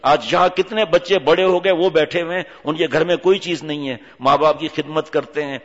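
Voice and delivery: ordinary talk, nothing marked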